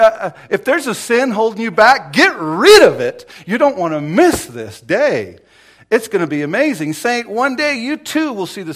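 loud, high pleading call to the congregation; urgent preaching. Real, not acted. Preacher urges the congregation to give up sin, loud and pleading. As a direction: plead with them not to miss it.

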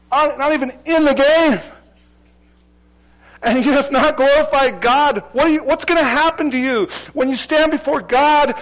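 high, loud sermon push; preaching at full force. Real, not acted. Preacher warning the church, loud and high. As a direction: drive the warning home loudly.

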